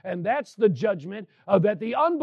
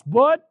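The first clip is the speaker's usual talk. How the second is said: "but" punched high and much louder; emphatic BUT; 6 semitones higher, 11 dB louder than usual. Real, not acted. Preacher flagging the turn for the congregation, emphatic "but". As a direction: hit "but" hard as the turning point.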